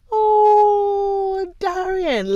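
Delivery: high, loud oh of delight; light up at the friend's name; thrilled oh. Real, not acted. Delighted reply to a viewer.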